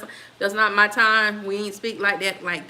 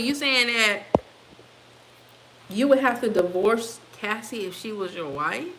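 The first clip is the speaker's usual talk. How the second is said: usual speaking voice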